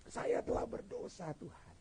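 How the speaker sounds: very soft, breath-like penitent prayer; hushed confession. Acted. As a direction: confess it to God, fading almost to nothing. (pengakuan dosa yang lirih)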